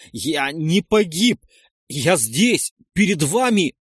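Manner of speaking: loud, drawn-out protest; loud, anguished declaration; desperate insistence. A narrator's reading.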